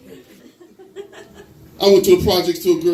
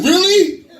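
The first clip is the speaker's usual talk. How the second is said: mock astonishment; 5 dB louder than usual. Trooper taken in by the joke.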